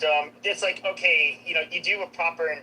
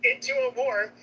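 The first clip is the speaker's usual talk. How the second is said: yelling; 7 semitones higher than usual. Real, not acted. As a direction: shout the point over him. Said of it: "why are you yelling".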